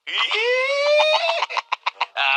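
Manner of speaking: high shrieking burst of laughter; loud teasing laugh. Acted. Teasing mother about new man. (malakas at matinis na tawa ng panunukso)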